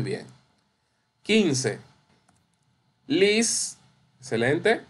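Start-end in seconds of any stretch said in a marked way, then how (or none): 3.1-3.8 "Lis" said alone, high and stressed; stressed syllable LIS. Shown as a demonstration. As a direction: isolate "Lis" as the strong syllable. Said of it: "Eso es la sílaba tónica".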